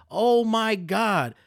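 high, slow exasperated cry; riled up. Real, not acted. Host losing patience with complainers, exasperated. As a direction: drag it out in disbelief. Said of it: "I'm getting a little riled up".

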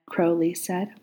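ordinary delivery, nothing marked

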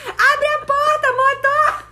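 high, loud, long-stretched call to a driver; full-voiced drawn-out shout, reenacting a friend; imitated yelling. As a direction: holler it out, dragging each word. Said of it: "Ela gritou assim".

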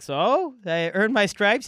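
high, loud, expectant question; cocky retold question. Comic to club owner after his set, cocky and loud. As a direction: push it up high, fishing for approval.

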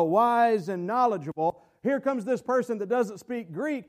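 usual speaking voice

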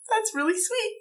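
small, soft, high-pitched voice; hushed, high little reaction; touched, teary moment. Retold or imitated. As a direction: imitate being moved, very high and soft. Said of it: "I did tear up".

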